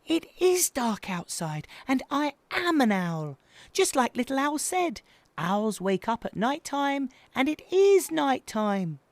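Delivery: put-on high, insistent baby owl; indignant squeaky protest; defiant. A narrator's reading. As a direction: argue your case in a little voice.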